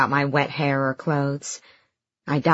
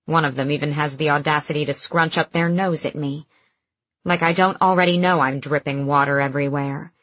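usual speaking voice

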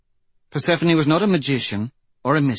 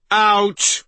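sharp ouch; 10 semitones higher, 7 dB louder than usual. Acted. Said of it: "Aryan shouted".

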